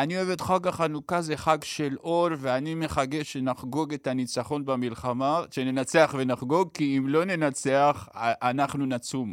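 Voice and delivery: Yosian imitation. Lightly imitating Yosian wishing viewers a happy Hanukkah. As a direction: drag the holiday blessing out slowly. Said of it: "אז הוא אמר".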